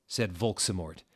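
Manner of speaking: ordinary delivery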